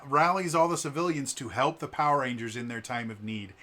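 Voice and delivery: ordinary talk, nothing marked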